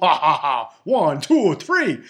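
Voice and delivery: loud, hammed-up Transylvanian vampire counting; Count from Sesame Street. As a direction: do a cartoon vampire's laugh and count. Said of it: "The Count from Transylvania. He was like".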